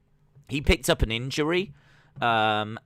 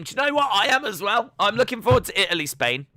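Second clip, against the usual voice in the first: excited; 10 semitones higher, 6 dB louder, faster than usual. Real, not acted. Excitedly agrees with chat.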